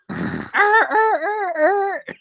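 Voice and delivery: groggy laugh. Real, not acted. Just woke up, laughing.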